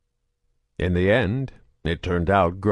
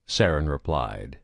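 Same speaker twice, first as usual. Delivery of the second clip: ordinary delivery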